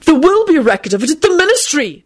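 high, heated announcement; indignant correction. A narrator's reading. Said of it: "Neville announced heatedly".

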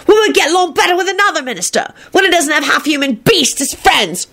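high, fast witch voice; shrill witch voice. A narrator's reading. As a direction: rattle it off like a spiteful witch.

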